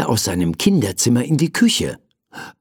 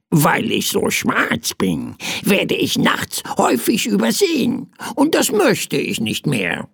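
high, raspy bird with caws; croaking bird; 8 semitones higher than usual. A narrator's reading. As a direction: explain it in a crow's croak. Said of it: "krächzte das kleine Federvieh". (krächzende, klagende Vogelstimme)

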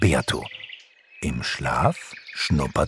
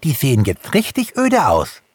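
high-pitched lively exclamation; calling out; 9 semitones higher, 4 dB louder than usual. A narrator's reading. Hase Langbein complains the woods look bleak, calling out. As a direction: exclaim it as an excited hare. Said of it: "rief Hase Langbein".

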